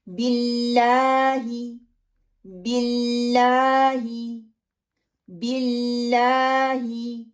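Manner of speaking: very slow BILLAAHI, long held LAA; practice recitation. Shown as a demonstration. Teacher leading learners to repeat, drawn-out बिल्लाही. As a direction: stretch बिल्लाही evenly, pure short I. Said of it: "थोड़ी सी practice कर लेते हैं".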